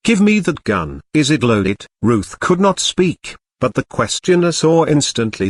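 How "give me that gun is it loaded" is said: plain reading voice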